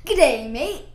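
amused amateur Australian accent. Shown as a demonstration. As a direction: put on a mock Australian accent. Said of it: "Can you guys do an Australian accent?".

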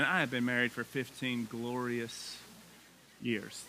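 slow, low, with a pause before "years"; deliberate, measured delivery, soft and low; careful mock praise. Shown as a demonstration. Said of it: "That's how you say that, right?".

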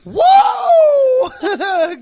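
very loud, high whooping yell; sudden long screamed whoa; high shout. Real, not acted. Sudden yell after long laughter.

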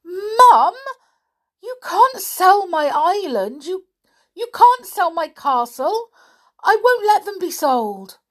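put-on high, loud, desperate girl's protest; a stubborn girl begging her mother not to sell; upset girl pleading. Daughter protesting her island sale.

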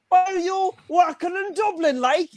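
put-on high, loud, slow Cork accent; mock Cork accent. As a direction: grill the newcomer in a shouty Cork accent. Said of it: "They were like".

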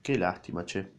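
dry low sarcasm; sarcastic. A narrator's reading. Mr A mocks the captain, sarcastic and low. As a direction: drip the sarcasm quietly. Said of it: "con tono sarcástico".

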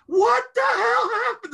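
high, loud, drawn-out shout from the other room; aunt's angry shout. Retold or imitated. Aunt hearing the vase smash, shouting from another room. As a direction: yell it from the next room, outraged. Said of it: "all you hear is my aunt".

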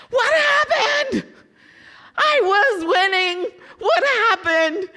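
high, loud, slow cry of disbelief with a laugh; shocked disbelief. Retold or imitated. Bettor watching her horse lose, shouting in high, slow disbelief. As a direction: wail it as the winner slips away.

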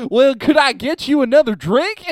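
high-pitched mock Southern belle accent; cute Southern woman. Host as Southern woman offering a drink, cute high accent. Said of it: "it makes women sound, uh, like, cute".

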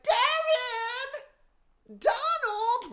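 very high, stretched falsetto calls; mock calling out. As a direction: imitate a woman hollering for her boyfriend. Woman calling out for boyfriend.

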